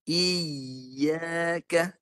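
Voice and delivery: Iyaka with a crisp, short i sliding up into ya; correct iyaka. Shown as a demonstration. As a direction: clip the i in Iyaka to one beat. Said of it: "belum lagi".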